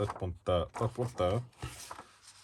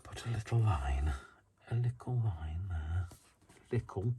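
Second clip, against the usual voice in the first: whisper. Real, not acted. Whispering while crafting.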